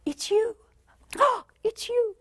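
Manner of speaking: high, delighted gasp and greeting; light up at seeing them; thrilled recognition. Retold or imitated. New partner greeting a loved one, high delighted. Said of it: "every time we see them, it's like".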